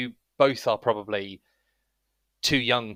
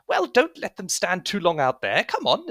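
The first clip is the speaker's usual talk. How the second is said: high, loud chiding call; third voice; 9 semitones higher, 4 dB louder than usual. Acted. Third voice urges him to let visitors in, chiding. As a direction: scold from inside in a high voice. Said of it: "you hear a third voice go".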